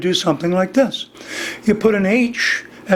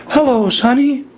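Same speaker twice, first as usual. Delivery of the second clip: raised-register hello; higher, projected demonstration voice; switched vocal gear; 4 semitones higher than usual. Shown as a demonstration. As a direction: shift up a gear in pitch. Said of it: "I've switched gears".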